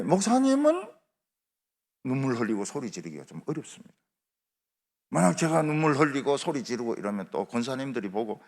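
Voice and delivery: ordinary talk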